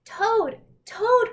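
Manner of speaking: high loud repeated calls; crying out. A narrator's reading. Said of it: "cried Frog".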